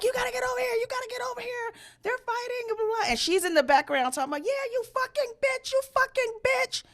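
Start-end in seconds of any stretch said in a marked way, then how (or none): 0.0-3.1 mock panicked voice, pitched way up; frantic high-pitched caller. 4.4-6.8 shrill mock yelling, pitched way up; mocking drunk girl.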